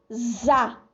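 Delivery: "za" said alone with a voiced Z; isolated syllable demo. Shown as a demonstration. Teacher sounding out a syllable for children. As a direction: pull out the syllable "za" with a buzzing Z. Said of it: "tem som de Z".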